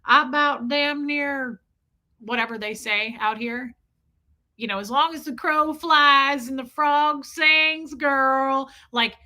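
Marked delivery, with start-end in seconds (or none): 0.1-1.6 slow, higher-pitched Southern drawl; long, stretched Southern vowels. Retold or imitated. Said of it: "A Southern person that's like". 4.8-8.7 slow, higher-pitched Southern drawl; long, stretched Southern vowels. Retold or imitated. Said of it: "A Southern person that's like".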